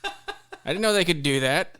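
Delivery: loud, high, laughing disbelief; laughing outburst. Real, not acted. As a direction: shout it out through laughter. Laughing outrage at landlord.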